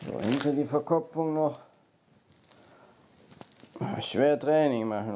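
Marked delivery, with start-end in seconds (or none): none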